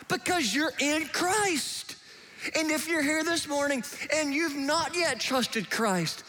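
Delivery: ordinary delivery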